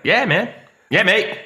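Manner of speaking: quick, easygoing imitation of Australians; imitating laid-back Australians. Imitating jovial Australians who are hard to offend, laid-back. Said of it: "They're like".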